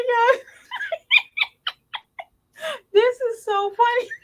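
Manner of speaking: high squeaky laughter through words; cracking up, barely able to talk; laughing hard. Real, not acted. Can't stop laughing.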